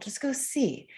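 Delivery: a hint of an old man urging kindly; gentle grandfather advice. Host voicing his grandfather urging him to go, lightly voiced. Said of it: "he was really the one who said".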